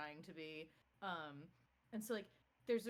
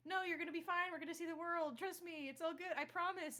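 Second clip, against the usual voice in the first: higher, hammed-up fake reassurance; mock reassuring husband; 9 semitones higher, 4 dB louder than usual. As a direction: play the husband lying sweetly to his dying wife.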